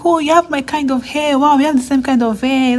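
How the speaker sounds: high, delighted exclaiming; delighted friend. Retold or imitated. Friend at game night delighted they share hair, high and excited. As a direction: mimic her delight at matching hair.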